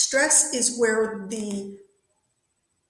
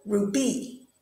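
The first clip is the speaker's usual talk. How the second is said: ru-BY, weight on the second syllable; wrong last-syllable stress. Shown as a demonstration. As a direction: stress the end of Ruby. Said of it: "it's on the Ru".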